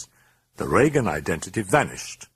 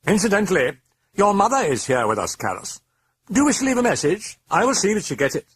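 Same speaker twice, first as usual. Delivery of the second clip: high-pitched demonic taunt; 14 semitones higher, 4 dB louder, faster than usual. A narrator's reading. Demon taunts Karras about his mother, high and gloating. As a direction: needle him in a high creature voice.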